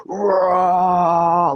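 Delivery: loud, drawn-out growling roar; imitated roar. Imitating the surging earth to listeners, big roar. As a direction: roar long and hard like surging energy. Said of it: "feel the earth being like".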